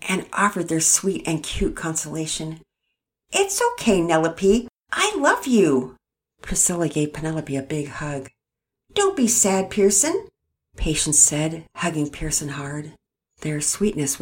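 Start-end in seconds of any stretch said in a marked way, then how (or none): 3.3-6.0 put-on high, sweet toddler voice; sweet little twin voice. A narrator's reading. 8.9-10.3 lightly voiced small child, sweet; a hint of a sweet little kid; little twin. A narrator's reading.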